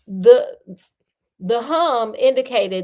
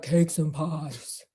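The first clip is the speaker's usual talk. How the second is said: imitating a movie chick; 6 semitones lower, 5 dB quieter, slower than usual. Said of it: "that chick, they be like".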